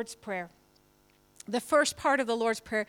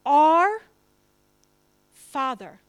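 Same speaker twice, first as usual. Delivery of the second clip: "Our" punched loud, then a pause before "Father"; stress on Our; 2 semitones higher, 11 dB louder, much slower than usual. Shown as a demonstration. Pastor stressing whose Father it is, punching "Our". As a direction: hit "Our" hard and let "Father" follow. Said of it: "What does that right away tell us?".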